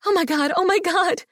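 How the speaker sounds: very high, breathless joy; bursting with happiness; ecstatic squeal. A narrator's reading. Overjoyed woman after proposal.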